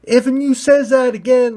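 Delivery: high, loud bumpkin threat. Acted. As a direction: warn them you'll fight. Pointy ball threatens.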